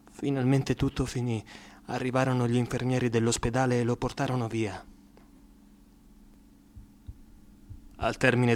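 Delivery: a quiet, low, sombre telling; sombre story narration. A narrator's reading. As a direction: tell it gently, looking back. Quiet narration after the scene.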